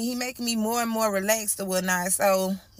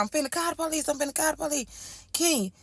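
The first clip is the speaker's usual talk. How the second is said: higher, pressing voice of a scared mother; urgent mom; 5 semitones higher than usual. Retold or imitated. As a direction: tell him to get out like a worried mom. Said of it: "My mama called".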